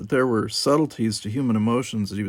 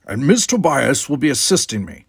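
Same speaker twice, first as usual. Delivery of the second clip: louder, higher Klingon retort; Worf's pointed addition; 4 semitones higher, 6 dB louder than usual. Acted. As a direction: turn the tables on her. Worf adds pointedly.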